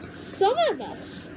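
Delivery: high, slow, lightly throaty; throaty witch voice. Acted. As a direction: lean in and hint darkly. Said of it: "whispering in a throaty sort of voice".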